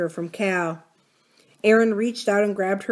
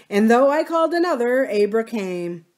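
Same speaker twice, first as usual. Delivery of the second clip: with relish; 4 semitones higher, 4 dB louder than usual. Acted. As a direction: relish the line, lingering on Abra. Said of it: "Her father repeated with relish".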